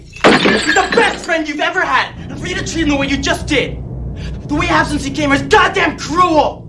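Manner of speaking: very high, shouted scolding; enraged outburst defending a friend; furious reproach. Acted. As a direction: berate them at a screaming pitch.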